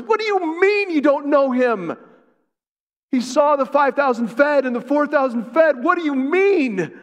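preacher shouting at Peter's denial; impassioned preaching. Real, not acted. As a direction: thunder the disbelief at Peter. Preacher at full force.

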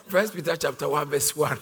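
amused laugh breaking into the words. Real, not acted. Pastor repeats the reading after accent teasing, laughing. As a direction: announce the reference through laughter.

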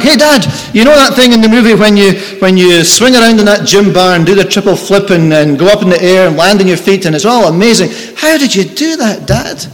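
put-on excited little boy. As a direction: rush it like a thrilled kid. Boy returns excited again.

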